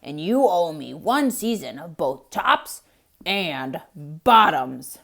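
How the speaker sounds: low, loud, slow growl; growling bear. Acted. Bear demanding his due from Hare, low growl. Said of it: "Bear growled".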